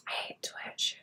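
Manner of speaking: breathy whispered grumble. Real, not acted. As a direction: whisper it to yourself. Whispered grumble while painting.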